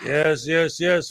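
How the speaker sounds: usual speaking voice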